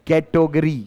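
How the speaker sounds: "कैटेगरी" said low and deliberate, as ke-to-ga-ree; correct pronunciation. Shown as a demonstration. Teacher showing the class how "कैटेगरी" is pronounced, correct pronunciation. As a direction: say "कैटेगरी" carefully with an "o" in the middle. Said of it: "This is the correct pronunciation".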